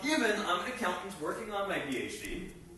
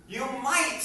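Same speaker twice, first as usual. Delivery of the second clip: might pressed higher and louder; stress on might; 9 semitones higher, 7 dB louder than usual. Real, not acted. Preacher to congregation about fasting, stress on might. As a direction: lean hard on the word might. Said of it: "I emphasize the word might".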